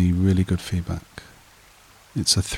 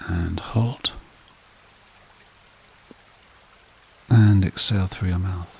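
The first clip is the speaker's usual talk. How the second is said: guided meditation; 4 dB quieter than usual. Acted. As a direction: lull them evenly, softening as each phrase settles. Leads a breathing meditation.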